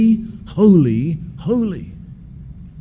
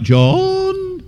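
put-on high sing-song call of the boys' names, louder at the end; yodelling call; 13 semitones higher, 6 dB louder, much slower than usual. Mother calling her sons to account with a high yodel. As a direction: call the boys like a mother who's spotted missing tarts. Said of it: "my mother would call out".

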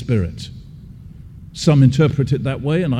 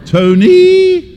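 put-on high sing-song call of the boys' names, louder at the end; yodelling call; 13 semitones higher, 6 dB louder, much slower than usual. Mother calling her sons to account with a high yodel. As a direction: call the boys like a mother who's spotted missing tarts. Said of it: "my mother would call out".

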